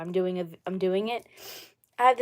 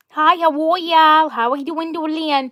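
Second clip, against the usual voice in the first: high, loud, drawn-out greeting; put-on character voice; 6 semitones higher, 7 dB louder than usual. Shown as a demonstration. Streamer demonstrating her character's greeting, high drawn-out voice. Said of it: "She talks like that".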